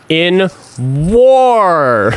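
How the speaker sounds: very drawn-out impression of a politician; Trump. Host quoting the president on deaths, long drawn-out impression. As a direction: stretch "war" out long and swooping. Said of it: "I need to update my Trump impression".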